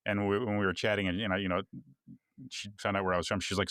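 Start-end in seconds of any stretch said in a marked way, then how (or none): none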